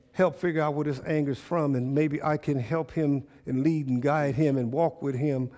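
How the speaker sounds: soft, hushed praying aloud; quiet prayer. Acted. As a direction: pray it softly, almost to yourself. Prays aloud as a congregant.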